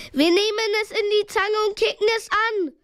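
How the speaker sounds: high-pitched rallying call; urgent, high projected voice. A narrator's reading. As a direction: shout a plan to friends. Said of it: "rief Justus".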